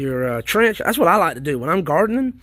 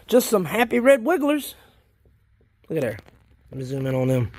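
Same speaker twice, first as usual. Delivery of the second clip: usual speaking voice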